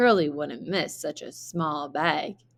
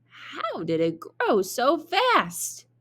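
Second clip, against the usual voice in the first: wondering Jack; 7 semitones higher, slower than usual. Acted. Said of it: "wondered Jack".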